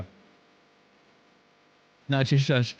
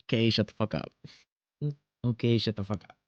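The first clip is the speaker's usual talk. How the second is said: lower, a light copy of the clip; imitating clip; 7 semitones lower, 5 dB quieter, faster than usual. Streamer copying a clip's voice, light low imitation. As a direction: echo the clip's line back. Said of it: "Let me listen to your voice again".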